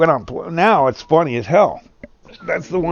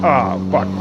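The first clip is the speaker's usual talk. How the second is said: ordinary talk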